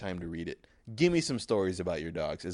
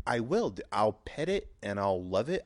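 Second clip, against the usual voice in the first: ordinary talk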